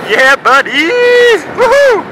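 high, loud whoop of joy; excited cheering. Real, not acted. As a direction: whoop it out as you roll off. Setting off downhill, thrilled.

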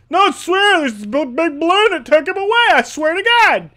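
high, loud, pleading yell; frantic insisting. Acted. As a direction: plead desperately to be believed, hammed up. Voices Carl insisting on what happened.

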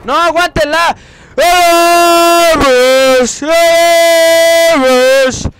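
loud high hype shouts; excited shouting. Real, not acted. Gamer cheering at the end of the match, loud hype shouts. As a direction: yell to push the play through.

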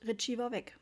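ordinary delivery, nothing marked

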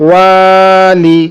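slow, level, sustained first vowel in "ওয়ালি"; stretched reading. Shown as a demonstration. Teacher reading through for students, "ওয়া" drawn out. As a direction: hold "ওয়া" long in "ওয়ালি", then short "লি". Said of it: "টানা পড়ে চলে যাবো".

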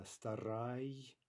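старай drawn out slowly and clearly; very slow model. Shown as a demonstration. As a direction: stretch the word out for the learners. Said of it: "teacher models the form slowly".

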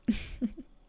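short, airy little laugh; light chuckle. Acted. Modest laugh after boasting of guests.

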